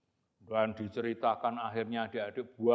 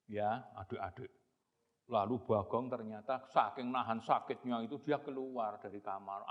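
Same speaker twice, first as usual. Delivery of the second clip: usual speaking voice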